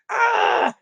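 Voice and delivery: high sharp startled shriek; a short high scream. Acted. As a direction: yelp in shock. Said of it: "George shrieked".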